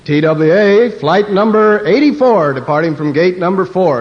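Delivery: mock airline PA voice; airport announcer. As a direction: announce flights over a terminal PA. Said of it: "listening to them announce the planes".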